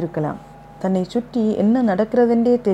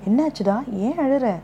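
alarmed concern; 6 semitones higher than usual. A narrator's reading. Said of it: "Meera பதறிப்போனாள்".

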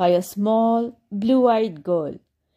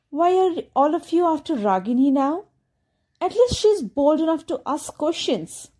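crying; 6 semitones higher than usual. A narrator's reading. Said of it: "Aisha began to cry and said".

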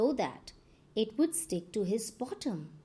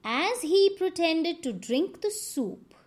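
usual speaking voice

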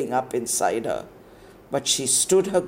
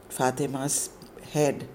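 plain reading voice

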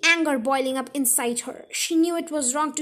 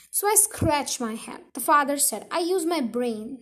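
usual speaking voice

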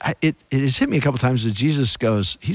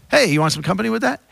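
quick, high friendly offer; Uncle John's offer; 8 semitones higher, faster than usual. Retold or imitated. Imitating Uncle John offering company to strangers, quick and cheery. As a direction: toss out a cheery invite. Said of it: "he would use that phrase".

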